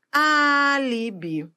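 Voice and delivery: "Alibi" with a long, high AAA, then falling; stressed first syllable. Shown as a demonstration. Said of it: "Proparoxítona".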